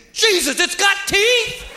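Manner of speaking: high, drawn-out mock panic; panicked kid. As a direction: play a child's horrified realisation. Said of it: "see the kid's mind thinking".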